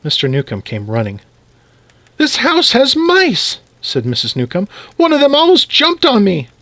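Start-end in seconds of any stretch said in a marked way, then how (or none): 2.2-3.6 slow, high-pitched wail of alarm; alarmed wife. Acted. Frightened wife complains of mice. 5.0-6.5 high-pitched, panicky complaint; alarmed wife. Acted. Frightened wife tells of mouse.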